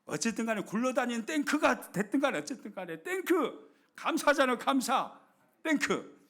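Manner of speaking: 땡크 called out loud and high, Korean-style; emphatic thank. Real, not acted. Preacher to congregation, loud and high emphatic call. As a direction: shout 땡크 and 감사 with gusto. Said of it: "저는 안 되더라고요".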